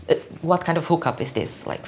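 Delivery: ordinary talk, nothing marked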